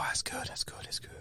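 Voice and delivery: breathy whisper of delight. Acted. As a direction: whisper it, savouring the beer. Whispered beer appreciation.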